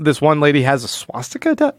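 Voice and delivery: ordinary talk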